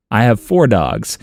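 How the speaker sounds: ordinary talk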